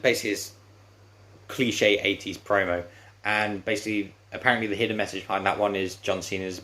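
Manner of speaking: ordinary delivery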